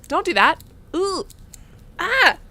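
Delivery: high, loud yelps of alarm; startled. Real, not acted. Streamer reacting to scary game, startled yelps. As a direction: recoil with short, sharp cries.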